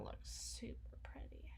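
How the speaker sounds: breathy whispered tease. Acted. Vampire whispering to her human about the bites, softly teasing. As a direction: lean in close and whisper.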